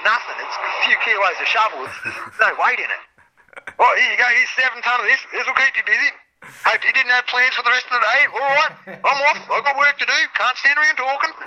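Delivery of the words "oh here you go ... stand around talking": higher, brisk, lightly voiced tradie; breezy bloke in a hurry, pitched up; imitating the mate. Mate dumping seven tonne and rushing off, lightly voiced breezy tradie.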